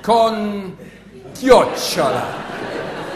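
slow, drawn-out tourist Italian; halting phrasebook tourist. Pretend. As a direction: stretch out Con chiocciola like a tourist. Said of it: "you say".

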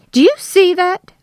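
high, loud, outraged snap; snapped. A narrator's reading. Wife points out husband's injury, high indignant snap. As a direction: demand that they look, incensed. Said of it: "Darlene snapped".

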